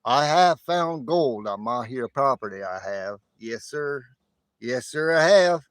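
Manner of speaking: loud, emphatic imitation West Texas accent; hammed-up West Texas drawl; West Texas Stacker. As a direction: declare it proudly in a put-on West Texas accent. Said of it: "I'll do a West Texas stacker accent".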